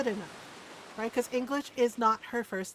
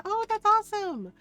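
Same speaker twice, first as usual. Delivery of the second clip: excited delight; 10 semitones higher, 5 dB louder than usual. Real, not acted. Reacting to raider's game.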